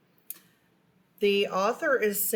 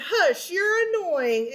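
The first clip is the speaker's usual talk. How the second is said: annoyed classmate; 9 semitones higher, 4 dB louder than usual. Acted.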